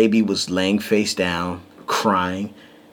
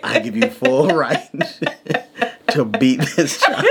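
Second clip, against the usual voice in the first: a lightly voiced stern elder, laughing; half-laughing elder blessing; mock ancestor voice; 5 semitones higher than usual. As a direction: grant permission like an old relative, amused. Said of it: "the ancestors inside of me are like".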